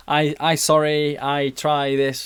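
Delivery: put-on slow, halting Turkish-accented apology; slow broken English with a Turkish accent. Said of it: "in his half Turkish accent".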